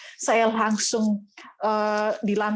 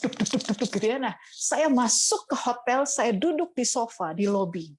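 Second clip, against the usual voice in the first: normal talking voice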